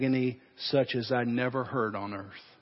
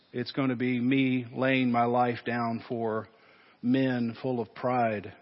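closing prayer. Acted. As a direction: speak gently to God before communion. Pastor leads closing prayer.

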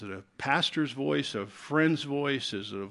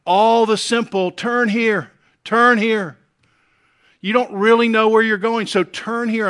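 loud, high calling voice; 8 semitones higher, 10 dB louder than usual. Retold or imitated. Lady Folly calling the simple to turn in, loud and high. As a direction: call out to the simple.

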